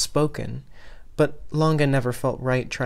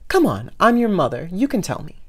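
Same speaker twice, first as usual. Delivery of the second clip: coaxing mother; 6 semitones higher, 5 dB louder than usual. Acted.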